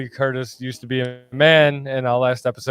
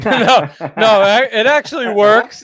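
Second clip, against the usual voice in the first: laughing through the words, higher and louder; laughing protest; 6 semitones higher, 5 dB louder than usual. Real, not acted. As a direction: protest while still laughing.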